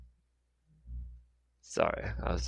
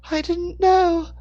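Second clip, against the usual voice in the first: put-on very high, loud child voice; little boy; 9 dB louder, slower than usual. A narrator's reading. Lost boy answering about his parents, little boy. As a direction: pitch it far up as a small boy.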